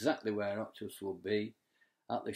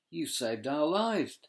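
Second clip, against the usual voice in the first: gasped; 6 semitones higher, 5 dB louder, slower than usual. Acted. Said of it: "gasped Dolphin".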